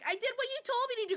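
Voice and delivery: high, fast voice for the woman; high quoted voice. Retold or imitated. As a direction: quote her high and quick. Retold wife quote.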